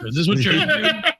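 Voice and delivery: high squeaky dog voice; dog's voice. Pretend. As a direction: ask as an annoyed pet. Voicing the dog.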